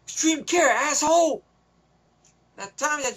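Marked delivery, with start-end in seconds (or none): 0.1-1.4 imitating an angry sheriff, higher pitch; retold order, raised and emphatic; mock stern order.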